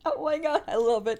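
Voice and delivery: ordinary talk, nothing marked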